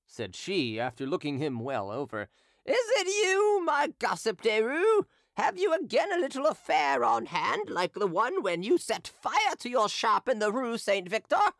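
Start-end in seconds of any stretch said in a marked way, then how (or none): 2.6-11.5 put-on drunken hawker. Drunken hawker taunting Derues before the crowd, high mocking voice. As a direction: taunt him gleefully like a drunk street seller.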